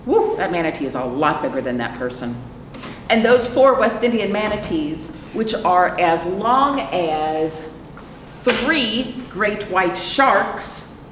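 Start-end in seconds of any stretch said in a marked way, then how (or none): 3.1-11.0 slow, drawn-out picture-book read-aloud; slow storytime reading. A narrator's reading. Librarian reading the book to children, slow storytime read. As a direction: read each phrase slowly to the little ones.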